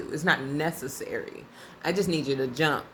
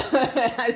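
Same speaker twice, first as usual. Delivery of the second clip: real laugh; 7 semitones higher, 4 dB louder than usual. Real, not acted. Laughing at his aggression.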